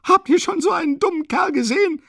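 very high, loud hysterical cry. A narrator's reading. Katerina turning to everyone around about Luschin, hysterical. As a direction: cry it out shrilly, beside yourself. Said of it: "lachte hysterisch".

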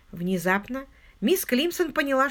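usual speaking voice

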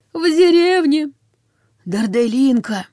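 high, slow rasp of a dying corpse; dead man wheezing out the village; gurgling corpse. A narrator's reading. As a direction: force the words out slowly, high. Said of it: "забулькал, захрипел".

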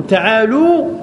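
تعالوا said ta'ālū, ending in long "oo"; damma on the lam. Shown as a demonstration. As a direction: round the lam of تعالوا into "loo". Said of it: "وضم اللام في أنتم".

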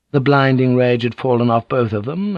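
usual speaking voice

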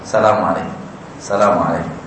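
low, measured "Assalamualaikum"; correct greeting. Shown as a demonstration. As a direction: say "Assalamualaikum" low and steady, properly. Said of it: "Bahasa yang benar". (salam yang benar, rendah dan tenang)

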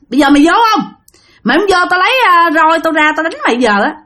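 high, mock-furious warning; angry threat. Master threatening the girl with a rod, angry. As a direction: threaten as if out of patience. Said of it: "ổng mới tức quá".

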